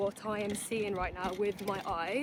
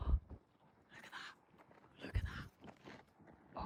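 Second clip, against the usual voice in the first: awed whisper; 13 dB quieter, much slower than usual. Real, not acted. Awed at the overlook view.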